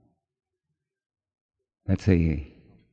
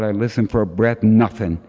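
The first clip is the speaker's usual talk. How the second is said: ordinary talk, nothing marked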